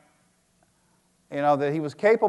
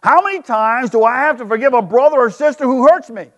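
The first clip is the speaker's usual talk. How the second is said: Peter asking; 5 semitones higher, 4 dB louder than usual. Acted. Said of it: "got up the nerve to ask".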